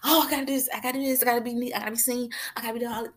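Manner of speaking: mock anxious fussing; mock fretting. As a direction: mimic someone fussing.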